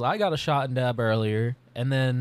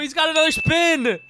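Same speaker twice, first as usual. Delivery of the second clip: much higher, loud hype call; excited shout; 13 semitones higher, 7 dB louder than usual. Real, not acted. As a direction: hype the spin. Excited about wheel spin.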